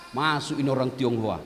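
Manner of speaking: ordinary talk, nothing marked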